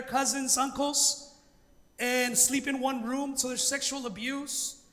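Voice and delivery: usual speaking voice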